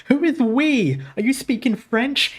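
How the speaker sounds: half-hearted impression of a huffy father; mock Heavenly Father. Recalling Heavenly Father's huffy line, a light mock voice. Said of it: "Heavenly Father was like".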